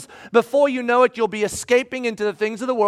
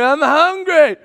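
mock whiny child, higher and louder; kid demanding; 6 semitones higher, 7 dB louder, slower than usual. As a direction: play the hungry kid waking you. Kid shouting at parent.

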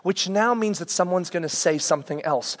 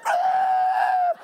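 much higher, breathy mock scream of a hurt boy; imitated pain scream. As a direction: yelp like the kid hit by the door. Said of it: "Cole's screaming because his head hurts".

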